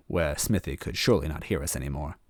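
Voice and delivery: ordinary delivery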